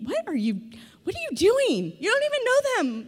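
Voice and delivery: high, incredulous mock disbelief; a wife baffled by her husband's oversharing; exasperated thought. Wife inwardly asks husband what he is doing, incredulous.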